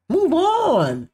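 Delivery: high-pitched, stretched dismissal; mock dismissal. Host acts out dropping the show, dismissive. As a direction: send it way up high to wave it off. Acted decision to drop the show.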